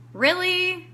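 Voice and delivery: high-pitched incredulous question; mock incredulity; retold disbelief. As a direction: question yourself, voice lifted in disbelief. Retold disbelief at own thought.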